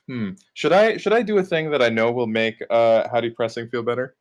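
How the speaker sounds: ordinary delivery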